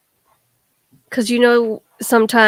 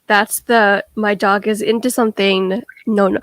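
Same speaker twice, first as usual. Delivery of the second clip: ordinary talk, nothing marked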